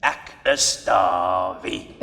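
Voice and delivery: slow, drawn-out Afrikaans cartoon accent. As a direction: stretch Ek is Davi slowly like an Afrikaans cartoon. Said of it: "practiced to execute that accent".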